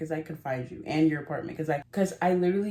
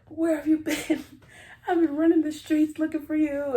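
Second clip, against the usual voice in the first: put-on frantic boyfriend; 10 semitones higher, 6 dB louder than usual. Boyfriend voiced, frantic on phone.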